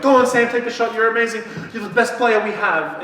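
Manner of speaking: high, loud fawning cheer; gushing cheer. Retold or imitated. Judith cheering Sam on at basketball, high gushing cheer. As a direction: cheer her on like a superfan.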